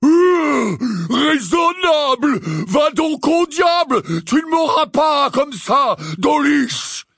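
slow, loud, furious roar. A narrator's reading. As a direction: roar defiance at the attackers. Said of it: "poussa un nouveau rugissement".

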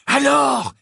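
high, loud, gleeful cry; excited demand. Acted. Ron, after laughing, demands the details. (cri excité, aigu et fort)

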